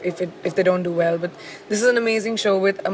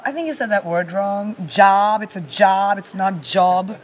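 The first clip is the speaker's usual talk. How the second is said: dialect coach; slower than usual. Retold or imitated. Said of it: "my dialect coach say".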